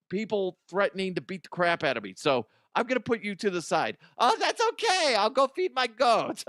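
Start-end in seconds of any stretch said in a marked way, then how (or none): 4.2-6.4 much higher, loud sheepish voice; high character voice. Acted. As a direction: answer way up high, meekly.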